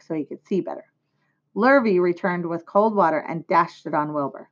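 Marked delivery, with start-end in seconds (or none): none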